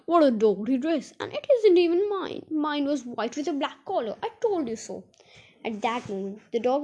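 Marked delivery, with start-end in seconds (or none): 0.0-5.0 high, cross complaint; put-out, scolding outcry; crying out indignantly. A narrator's reading. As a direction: exclaim in dismay, pitch raised. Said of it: "cried Dame Grumble".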